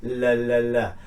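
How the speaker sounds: "la, la, la" as repeated humming L sounds; voiced L. Shown as a demonstration. Teacher demonstrating the L sound, level voiced "la". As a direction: run "la" three times on a level note. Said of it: "If you say the L sound".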